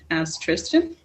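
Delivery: usual speaking voice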